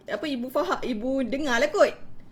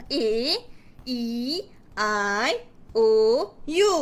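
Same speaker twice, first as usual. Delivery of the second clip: each vowel slow and separate, with pauses; storytelling technique; 3 semitones lower, slower than usual. Shown as a demonstration. Teacher introducing vowel siblings to a child, expressive storytelling. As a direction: present the vowels one at a time, swooping. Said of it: "Jadi kita buat teknik perceritaan macam ni".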